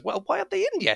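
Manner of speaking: very high nagging question; third voice. Acted. Third voice inside nags about waiting guests, impatient. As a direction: nag in a high put-on voice. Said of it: "you hear a third voice go".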